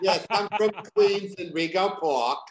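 put-on loud, high Queens accent; New York accent. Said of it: "I was talking like this".

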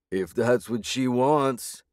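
slow, mocking thug voice. A narrator's reading. Thug taunting the sobbing girlfriend, slow and mocking. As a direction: sneer it out slowly. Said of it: "another thug laughed".

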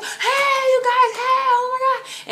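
very high, loud squealed hello; excited greeting. Retold or imitated. Prodigy seeing her and friends, excited greeting. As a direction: imitate an overjoyed shout of welcome. Imitates star's delighted greeting.

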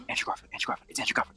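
hushed theater crowd whisper. Retold or imitated. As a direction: breathe the names like an excited audience. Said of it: "The whispers".

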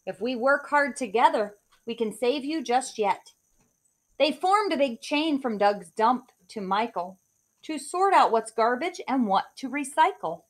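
put-on lively rhyming read-aloud for kids; story narration. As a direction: read it like a bedtime picture book. Reads picture book aloud.

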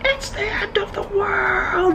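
high, drawn-out mock scream; stretched high-pitched cry, not full volume; imitated scream. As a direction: wail it high like a doomsday shouter. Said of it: "someone was screaming".

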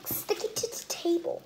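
whispering sticking to the table softly; whispered. Shown as a demonstration. Kid whispers to viewers about sticky slime. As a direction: breathe it like a secret. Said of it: "repeated after plain take".

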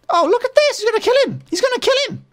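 excited squeal. Real, not acted. Gamer watches the skeleton kill the Enderman, squealing with glee. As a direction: squeal with delight at the fight. Mobs fighting each other.

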